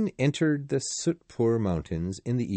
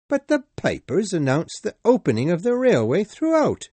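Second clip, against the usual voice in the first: high, loud indignant general; indignant protest; 9 semitones higher, 6 dB louder than usual. Acted. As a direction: protest to the conductor. Sir Francis protests.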